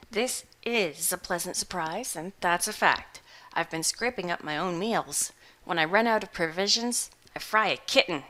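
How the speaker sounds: a hungover hermit shrugging it off; gruff old farmer. Acted. Butterbloom continues.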